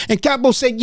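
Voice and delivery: usual speaking voice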